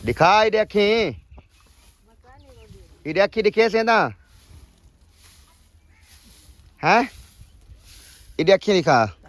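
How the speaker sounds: coaxing his mother, loud and insistent. Real, not acted. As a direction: lean in and insist she show her eyes. Son coaxing crying mother.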